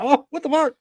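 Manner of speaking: high shocked yelp. Retold or imitated. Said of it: "I was like".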